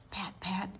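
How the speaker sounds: usual speaking voice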